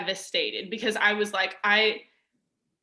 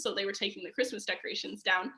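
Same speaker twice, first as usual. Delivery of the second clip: ordinary talk, nothing marked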